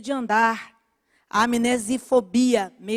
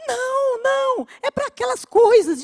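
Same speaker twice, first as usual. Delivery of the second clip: crying neighbor; 13 semitones higher, 4 dB louder than usual. Retold or imitated. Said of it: "e começou a chorar".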